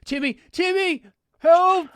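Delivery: high, loud cry for help; dramatic yell. Acted. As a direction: melodramatic shout to a friend for rescue. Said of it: "Paul yells".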